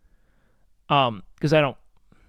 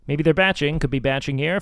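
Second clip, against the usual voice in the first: normal talking voice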